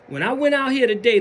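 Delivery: ordinary talk, nothing marked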